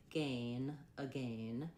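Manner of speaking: "again" built from "gain", long A diphthong; measured British "again", ending like rain; British pronunciation. Shown as a demonstration. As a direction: say "gain", then "again" to rhyme with it. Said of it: "for the British pronunciation".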